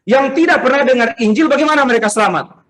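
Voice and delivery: lightly acted, insistent challenger; imitated questioner. Church member challenging the pastor by message, imitated questioner. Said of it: "Itu saya, eh, baru beberapa hari lalu di W A".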